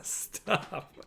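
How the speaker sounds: breathy whisper, barely voiced; whispered stop. Real, not acted. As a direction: hush it out as a whisper. Host cutting off self-deprecation.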